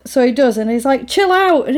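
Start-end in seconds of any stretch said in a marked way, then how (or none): 1.1-1.7 high, raised quarrel voice; imitating an argument, pitched right up; heated retort. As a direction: tell someone off in a high voice. Acted quarrel in the car.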